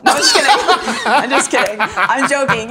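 laughing through the joke. Real, not acted. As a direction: giggle out the denial. Joking answer, laughing through it.

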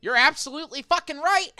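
high, loud, emphatic agreement; merchant conceding. Acted. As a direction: slam down the concession with delight. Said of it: "and he's like".

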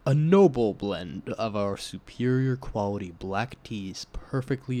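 slow, low, hushed seductive read; sensual reading. Pretend. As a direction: purr the tea blurb like a seduction. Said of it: "can you read it, like, sensually".

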